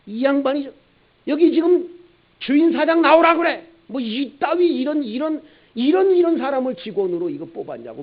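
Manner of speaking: very high, loud mock rage; hammed-up raging customer; furious shout. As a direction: yell for the boss in outrage. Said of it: "막 화를 벌컥 내면서".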